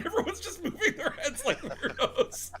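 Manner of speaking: high, loud, laughing delivery; laughing through words. Real, not acted. Streamer joking about heads moving like weirdos, laughing. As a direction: crack up while saying it. Cracking up at own joke.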